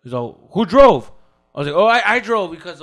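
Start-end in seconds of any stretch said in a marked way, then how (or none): 0.5-1.1 loud, higher demand; "who drove?" called out loud; husband demanding. Retold or imitated. Husband questions loudly. 1.8-2.8 flustered answer. Retold or imitated.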